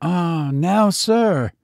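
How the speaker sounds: put-on high-pitched delighted old servant; joyful, high servant's exclamation; overjoyed servant. A narrator's reading. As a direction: cry out in relief, voice lifted high. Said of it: "cried the overjoyed Biggs".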